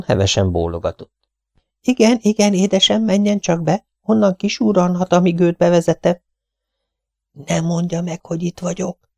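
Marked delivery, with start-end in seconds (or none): 1.8-6.2 high, fluttery spinster voice; fussy Miss Lawson. Acted. Miss Lawson offering soup to her guest, high and fussy. 7.3-9.1 high and much softer, hushed; whispering. A narrator's reading. Mrs Tenius begging her friend to hide her, whispering. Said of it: "suttogta Mrs Tenius".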